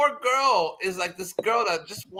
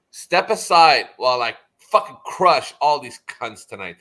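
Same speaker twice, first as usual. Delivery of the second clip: menacing threat; 4 semitones lower, 8 dB louder than usual. Retold or imitated. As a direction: lean into a boastful threat. Voicing Oz's threat.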